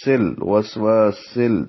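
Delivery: وسوسل slowly, whistling seen into lam; joined seen and lam. Shown as a demonstration. Teacher shows seen joined to lam, slow. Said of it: "سین کو لام سے ملائیں گے".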